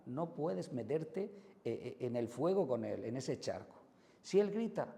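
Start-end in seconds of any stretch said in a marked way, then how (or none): 4.2-4.9 much higher and louder, mimicking the yell; he shouts. Shown as a demonstration. Demonstrating to parents the child's yell, loud and high. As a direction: pitch it up like a yelling child. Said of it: "tú hablas bajo".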